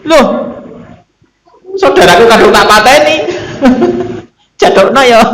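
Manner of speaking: high-pitched indignant complaint, breaking into laughter; comic squealed objection with a laugh; mock protest. Executioners protesting about brothers they already killed, mock protest. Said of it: "yang baru bunuh protes".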